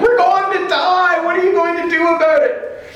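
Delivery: very high-pitched mock panic; hammed-up frantic squeal; panicking disciples. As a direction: cry out in exaggerated alarm. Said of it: "the disciples are freaking out".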